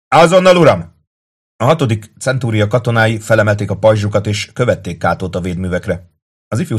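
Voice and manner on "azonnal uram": high, loud acknowledgement; shouted reply. A narrator's reading. Kato up to Tullius, shouting back.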